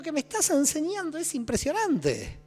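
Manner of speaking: high, loud, slowed gushing thanks; exaggerated high, loud gratitude; mock grateful student. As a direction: overplay the student thanking his tormentor. Voicing grateful student thanking Satan.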